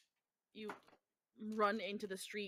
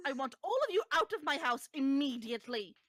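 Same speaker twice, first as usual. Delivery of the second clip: raised, outraged demand; shrieking hostess; 4 semitones higher, 4 dB louder than usual. Acted. Hostess demanding the group leave at once, shrieking. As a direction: order them out, high and indignant. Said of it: "You can hear her shrieking".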